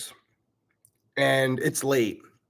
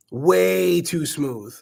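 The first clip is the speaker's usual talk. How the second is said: "Way" stretched long, higher and louder; emphatic repetition; 5 semitones higher, 4 dB louder, much slower than usual. Real, not acted. Dad on daughter's easy swearing, emphatic drawn-out "Way". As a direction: draw out "Way", then slow on "too smooth". Repeats his point for emphasis.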